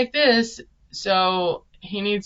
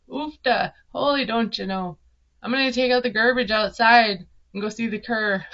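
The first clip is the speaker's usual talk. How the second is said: hammed-up Minnesota accent; playful folksy Minnesotan impersonation; 2 semitones higher than usual. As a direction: lay on a mock Minnesotan twang. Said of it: "do a Minnesota accent".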